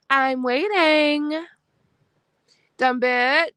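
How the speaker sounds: drawn-out sarcastic I'm waiting; taunting wait. Real, not acted. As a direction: stretch it slowly to taunt. Taunting a rival on stream.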